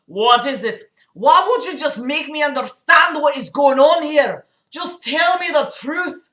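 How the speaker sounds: hammed-up, high, desperate outburst; overwhelmed crying. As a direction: build from pleading to a loud, overwrought cry. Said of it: "John cried from overwhelming emotions".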